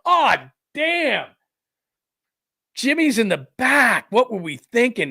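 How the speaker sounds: high, loud sudden realization of a stoned parent; mock parent panic. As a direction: play the parent who just remembered the kid in the back. Parents realize toddler forgotten.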